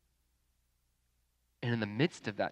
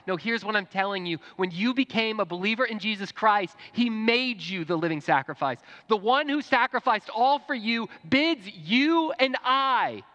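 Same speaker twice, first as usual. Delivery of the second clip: high, forceful sermon climax; impassioned preaching; 9 semitones higher, 6 dB louder than usual. Real, not acted.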